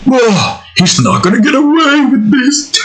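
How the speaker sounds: high, put-on cartoon villain voice; Eggman. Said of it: "sounds close to what that particular version of Eggman".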